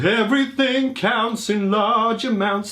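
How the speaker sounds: hyped song title. Real, not acted. Naming the big hit song.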